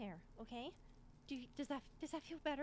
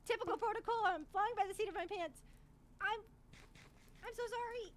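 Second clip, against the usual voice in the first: higher, louder, frantic stream of sorries; panicked apologizing; 6 semitones higher, 8 dB louder than usual. Acted. Healer to screaming patient, apologizing mid-surgery: higher, frantic, pleading. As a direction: rush through it, scared of the pain you're causing.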